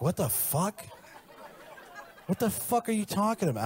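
high-pitched appalled girlfriend imitation; hammed-up incredulous outburst; mock disbelief. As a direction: react aghast, pitch jumping up. Girlfriend baffled by roleplay request.

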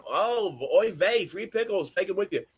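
put-on Yiddish accent. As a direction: kvetch it like a Wolfie's regular.

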